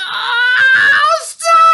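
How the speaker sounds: piercing, very high, drawn-out scream; yell at full volume. A narrator's reading. Tia is picked up by the ear, all-out shriek. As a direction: scream as loudly as you can. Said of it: "Tia yelled as loudly as she could".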